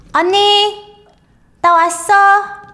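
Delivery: high bright call of "I'm home"; 언니 나 왔어 하고 부르듯이; calling out to sister. Retold or imitated. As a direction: call into the apartment in Korean, high and loud. Character calls home to sister.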